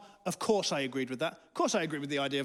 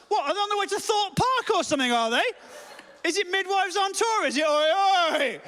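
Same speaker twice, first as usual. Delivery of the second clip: high, loud incredulous rant; outraged mockery; 12 semitones higher, 6 dB louder than usual. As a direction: jeer shrilly at the absurd outing. Mocking the midwives' minibus outing.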